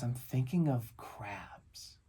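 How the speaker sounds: put-on slow, whispery little-boy answer; hushed child imitation. Son to Dad while staring into space, imitated slow whisper. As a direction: draw it out in a dreamy whisper. Son's dreamy answer, retold.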